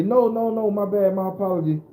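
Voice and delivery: high, hurried no no no, anxious to calm him; quickly backing off a scared stranger; flustered apology. Retold or imitated. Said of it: "I say".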